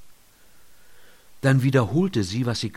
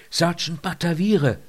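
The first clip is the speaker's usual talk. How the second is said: raised, higher call of the name; calling louder; 7 semitones higher, 5 dB louder than usual. A narrator's reading. Lynott tries again to rouse the sergeant, calling louder. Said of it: "rief sie etwas lauter".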